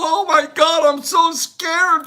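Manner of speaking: mock scared.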